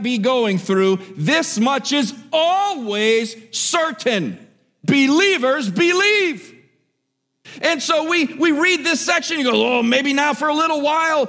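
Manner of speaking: high, loud, driving preacher; fervent preaching. Acted. Preacher urging the congregation, loud and fervent. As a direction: lift the church with certainty.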